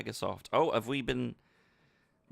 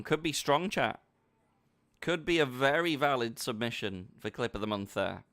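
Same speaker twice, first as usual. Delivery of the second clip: normal talking voice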